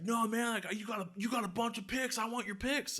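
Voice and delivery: put-on insistent drunk-fan voice, held back; pestering drunk. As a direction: plead high, imitating a pushy drunk.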